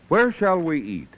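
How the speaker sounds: ordinary delivery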